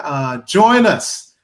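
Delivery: ordinary delivery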